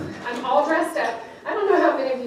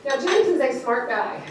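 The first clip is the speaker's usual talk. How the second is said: usual speaking voice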